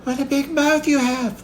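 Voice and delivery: put-on very high, small child's voice; high-pitched timid wonder; weak little-girl voice. A narrator's reading. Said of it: "murmured in a weak voice".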